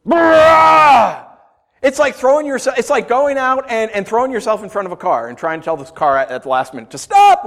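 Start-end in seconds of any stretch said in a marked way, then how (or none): 0.1-1.3 loud, high wordless roar; angry roar. Acted. Preacher mimicking a cursing person, angry roar. 7.0-7.4 sudden loud cry to halt; shouted command. Acted. Person yelling at a car at the last minute, shouted.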